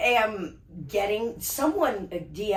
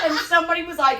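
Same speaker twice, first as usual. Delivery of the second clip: laughing; 9 semitones higher, 7 dB louder, faster than usual. Real, not acted. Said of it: "laugh heard".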